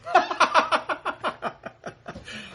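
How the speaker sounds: a long high real laugh, breaking up. Real, not acted. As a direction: laugh out at the punchline. Laughs after Rohn's punchline.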